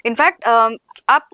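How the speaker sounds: usual speaking voice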